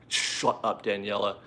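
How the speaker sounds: playful whispered shut up; mock whispered shut up. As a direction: hush her jokingly under your breath. Mock reply to viewer's suggestion.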